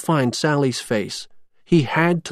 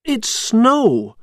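happy; 10 semitones higher, 4 dB louder than usual. A narrator's reading. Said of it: "Jack said happily".

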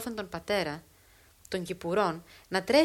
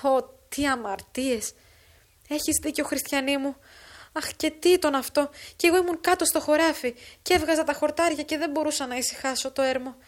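somewhat louder, higher lament; a hint of a grieving father wailing; lightly voiced distraught father; 5 semitones higher, 5 dB louder than usual.

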